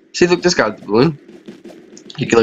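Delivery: normal talking voice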